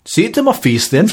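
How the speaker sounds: mock Indian accent. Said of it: "I thought you were a Indian accent".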